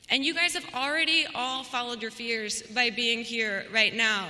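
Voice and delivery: ordinary delivery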